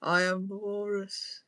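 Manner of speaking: lightly voiced walrus, slowed down. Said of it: "like a walrus".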